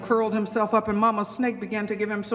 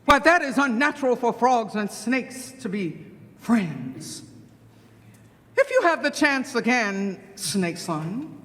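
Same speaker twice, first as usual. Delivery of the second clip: commanding mother snake. Acted. Mama Snake tells her son to eat Frog, ominously. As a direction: instruct with menacing pauses before the final word.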